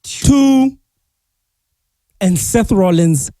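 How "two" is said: high ringside count, then slower; wrestling commentator. Acted. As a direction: call the pin like a ring announcer. Calling a match play-by-play.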